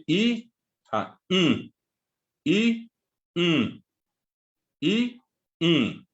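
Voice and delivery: the I held high and clear, repeated apart; vowel drill. Shown as a demonstration. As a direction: model the vowel I for the class to repeat. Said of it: "modeling a vowel". (la vocal I repetida, aguda y clara)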